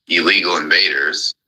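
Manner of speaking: ordinary delivery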